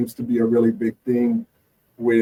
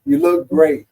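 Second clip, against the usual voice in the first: reassuring; 5 semitones higher, 3 dB louder than usual. Pretend. Coaching a patient on body image.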